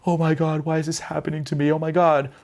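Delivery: high, frantic self-pity; panicked whining. Pretend. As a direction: whine like everything is against you.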